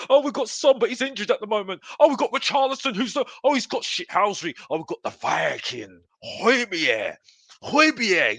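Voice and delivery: high, giddy mock fan gushing. Fan mocking fellow fans, high gushing fan voice. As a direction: gush over every player like a besotted fan. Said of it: "the same players that we are looking at and saying".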